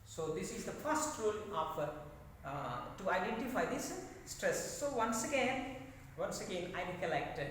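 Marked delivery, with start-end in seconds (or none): none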